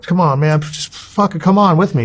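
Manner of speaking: eager, high-pitched retold plea; pleading urgently. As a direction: push him hard to come along. Urging uncle to join him.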